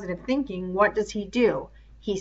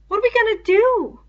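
put-on high, anxious boy's cry; high worried boy; worried Piggy voice; 6 dB louder than usual. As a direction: plead like a scared boy.

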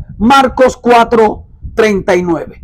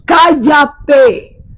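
sharp shouted command, higher; shouted rebuke; 3 semitones higher than usual. Retold or imitated. Jesus scolding the demon, a sharp shouted command. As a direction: bark the order to silence it. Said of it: "Lo reprendió, lo regañó".

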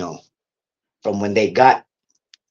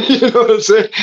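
higher and louder, laughing through it; amused laughing aside; 12 semitones higher, 8 dB louder, faster than usual. Real, not acted. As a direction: laugh while telling it. Laughing mid-story.

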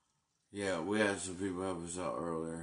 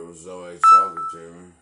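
yells it out, fast and much louder; sudden angry yell; 23 dB louder, faster than usual. Real, not acted. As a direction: bursts out shouting at the phone. Angry outburst over phone messages.

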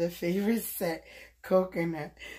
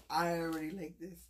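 soft repeat; 2 semitones lower, 7 dB quieter than usual. Real, not acted. Repeating her delight over the gift, soft and to herself. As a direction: murmur it again quietly.

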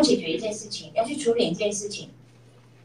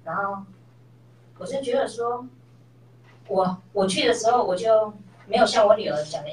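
ordinary delivery

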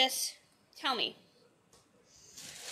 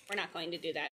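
normal talking voice

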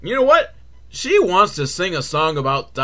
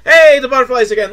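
high, loud, fast mock glee; all happy; 8 semitones higher, 9 dB louder, faster than usual. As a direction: burst in with hammed-up cheer. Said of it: "like, all happy".

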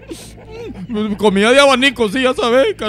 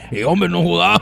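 much lower male voice scolding through giggles; lower laughing scold; 5 semitones lower than usual. Acted. Man telling drunk partner she's being filmed, low amused scold. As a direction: tease her to stop, low and amused.

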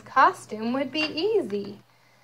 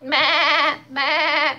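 louder, higher baa like a sheep; sheep bleat; 4 semitones higher, 7 dB louder than usual. Acted. Reading to child, a loud sheep bleat. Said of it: "bleated sheep".

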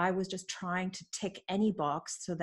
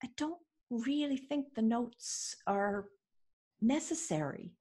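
slow, higher, halting pauses; tactful objection; 5 semitones higher, slower than usual. Retold or imitated. Coach correcting a note-taking client, slow and tactful. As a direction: pick the words slowly and carefully.

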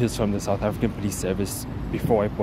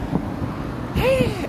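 sigh. Real, not acted. End of venting story.

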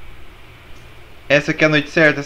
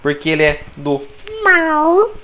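squeaky high voice; 12 semitones higher than usual. Acted. Explains Gary is evil.

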